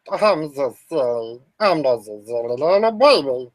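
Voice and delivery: put-on goof around in a silly baby voice. Streamer goofing after forgetting to mute, silly low baby voice. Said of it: "I'm not going to talk like this the whole time".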